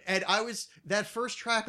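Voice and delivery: normal talking voice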